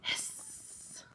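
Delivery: soft breathy hiss; snake hiss. Shown as a demonstration. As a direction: model the hiss for the kids. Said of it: "Can you make that snake's noise?".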